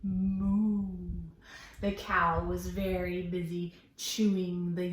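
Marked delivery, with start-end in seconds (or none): 0.0-0.9 put-on drawn-out moo for children; teacher mooing for the class; cow moo. Shown as a demonstration. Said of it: "what sound does a cow make?".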